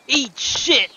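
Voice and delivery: high, loud mock-angry outburst; over-the-top character yell; hammed-up shout. Character's insult, then cracks up.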